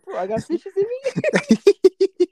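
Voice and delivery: high, slow, loud words squeezed through laughter; cracking up at the aquarium mix-up; laughing disbelief. Real, not acted. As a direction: crack up while asking it. Mocking friend's aquarium slip.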